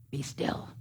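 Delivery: hushed, breathy be still; whispered be still. Shown as a demonstration. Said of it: "Sometimes you have to get really emphatic".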